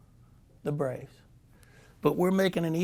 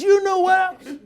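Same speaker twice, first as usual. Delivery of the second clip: high, loud mock rant through laughter; laughing high shout; 12 dB louder than usual. Manager mocking Saxy's throw, laughing and shouting high. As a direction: yell it while cracking up. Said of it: "And he says".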